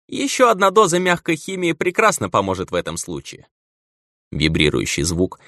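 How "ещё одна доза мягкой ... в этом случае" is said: much higher, dreamy doctor voice; giddy drugged doctor. Acted. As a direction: babble like a doped-up medic. Drugged doctor keeps babbling. (обдолбанный доктор)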